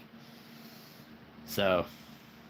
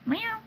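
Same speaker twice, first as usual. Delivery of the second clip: squeaky meow. Pretend. Playful cat noise for figure.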